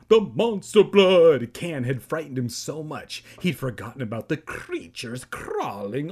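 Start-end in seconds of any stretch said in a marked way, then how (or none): 0.1-1.5 very loud, high, drawn-out outburst; alarmed exclamation. A narrator's reading. Narrator plays up Evan's fright, loud alarmed exclamation. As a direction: blast it out slowly in mock horror.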